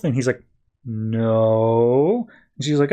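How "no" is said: long drawn-out no, curling up at the end; clueless hesitation. Retold or imitated. Amnesiac Superman answers whether he knows himself, cluelessly drawn out. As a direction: stretch it out as a mock-unsure answer.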